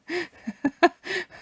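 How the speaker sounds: high burst of laughter; high giggle. Real, not acted. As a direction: giggle at your own joke. Laughing at own joke.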